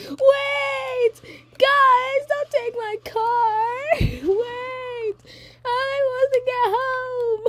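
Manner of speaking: very high, stretched-out mock wail; desperate wailing shout. Toya chasing his car as siblings leave, desperate wailing shout. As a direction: holler after them in a hammed-up, tearful screech.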